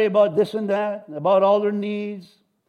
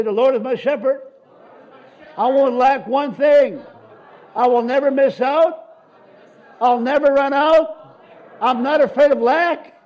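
loud, higher declaration of faith; congregational shout; 4 semitones higher, 5 dB louder than usual. Shown as a demonstration. Preacher leading the church in a loud shout. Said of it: "just to go and yell a little bit".

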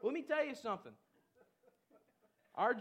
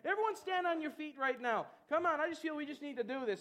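ordinary delivery

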